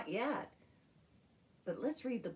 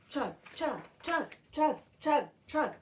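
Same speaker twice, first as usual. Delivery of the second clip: louder, higher chuck chuck; loud train chugs; 7 semitones higher, 8 dB louder than usual. Shown as a demonstration. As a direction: build the train beat up loud. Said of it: "got louder and louder".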